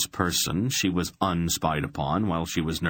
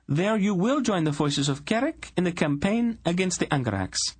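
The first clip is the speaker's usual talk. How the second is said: much higher, cold royal command; commanding queen voice; 13 semitones higher than usual. Acted. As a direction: hand down a decree as queen. Queen issues her decree.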